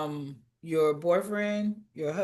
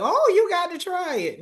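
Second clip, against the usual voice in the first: high, loud mock come-on; mock a pushy pickup line, pitched way up; exaggerated high-pitched coaxing; mimicking a pushy suitor; 12 semitones higher, 6 dB louder than usual. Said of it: "to be like".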